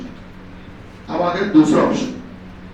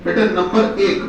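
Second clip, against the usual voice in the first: ordinary delivery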